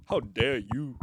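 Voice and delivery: lightly voiced offended South African guy; South African accent. To listeners, the character takes offense in South African accent.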